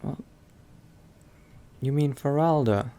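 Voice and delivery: usual speaking voice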